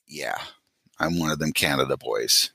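low, subdued mock confession; deadpan admission. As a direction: answer low and soft after the squeal. His own deflated reply, acted.

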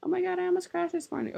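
high, fast mock valley girl. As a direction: squeal it like a ditzy teen. Mocking an annoying classmate.

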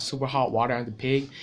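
usual speaking voice

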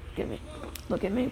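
ordinary talk

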